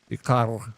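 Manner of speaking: kar with a throaty R at the back; kar, R rolled in the back of the mouth. Shown as a demonstration. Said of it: "You can do both".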